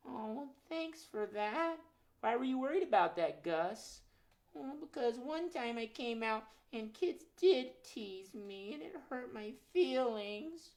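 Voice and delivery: high, slow, timid critter voice; shy little muskrat voice, drawn out; high timid puppet muskrat; nervous puppet. Acted. Puppet Gus speaks.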